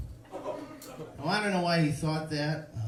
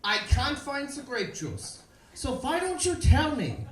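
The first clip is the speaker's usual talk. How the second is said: German interrogator; 10 semitones higher, 4 dB louder than usual. Retold or imitated. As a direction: interrogate like a cartoon Nazi about juice. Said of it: "He turns to me and he says".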